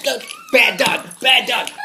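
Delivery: normal talking voice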